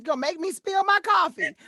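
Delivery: high, loud, through real laughter; laughing outburst. Real, not acted. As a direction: exclaim it while cracking up. Bursts out laughing at the line.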